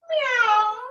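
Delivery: put-on a loud, high playful meow for the kids; high cat meow. Shown as a demonstration. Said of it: "for cat".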